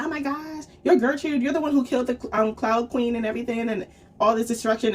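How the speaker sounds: high-pitched starstruck gushing; gushing fan. Retold or imitated. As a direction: gush like a fan meeting an idol. Acting out a starstruck fan meeting her idol.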